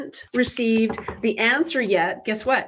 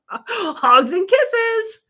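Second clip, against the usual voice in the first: very high, very slow giggly sign-off; 12 semitones higher, 5 dB louder, much slower than usual. Real, not acted. Host signing off to Jennifer, very high drawn-out giggle. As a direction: squeal it high and drawn out, laughing.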